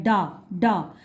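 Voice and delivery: डा डा said low and soft; syllable drill. Shown as a demonstration. Teacher to child, repeating the syllable डा. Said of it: "डा कौन से वर्ग में".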